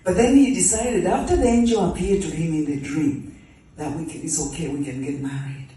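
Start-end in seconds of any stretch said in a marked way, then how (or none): none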